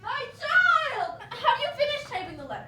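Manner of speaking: high, loud laughing taunt; shrill mocking laugh through the words; mocking laughter. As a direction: laugh in his face as you echo him.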